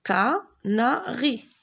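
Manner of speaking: "Canari" slow, syllables only briefly parted; syllables closer together. Shown as a demonstration. Teacher turning syllables into a word, syllables closer together. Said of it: "Je forme des mots avec des syllabes".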